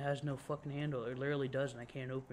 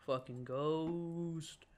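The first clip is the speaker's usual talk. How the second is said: slow, long-held "ghost"; drawn-out grumble; much slower than usual. Acted. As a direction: stretch "ghost" out in mock dread. Mock ghostly complaint while playing.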